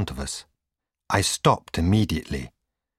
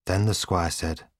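usual speaking voice

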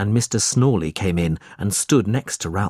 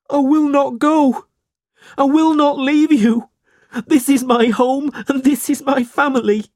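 high, loud, desperate refusal; crying out; 5 dB louder than usual. A narrator's reading. Smike refusing to leave his family, crying out frantically. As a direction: cry out in a high put-on boy's voice. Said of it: "Then Smike cried".

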